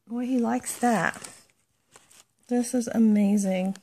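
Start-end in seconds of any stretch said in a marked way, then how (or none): none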